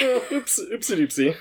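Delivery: high, babyish mock oopsie; playful oopsie. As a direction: coo it like to a toddler. Playful reaction to casting slip.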